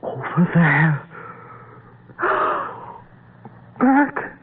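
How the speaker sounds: very high, gasping horror; terrified alarm. Acted. Man seeing the creature return, terrified. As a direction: choke out the words in rising terror.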